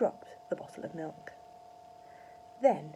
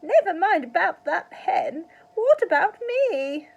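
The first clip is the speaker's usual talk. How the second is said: put-on high, loud and slow old woman's shout; drawn-out shrill granny yell; Grandma shouting; 11 semitones higher, 13 dB louder than usual. A narrator's reading. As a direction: wail for attention from the roof. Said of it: "Grandma shouted from the rooftop".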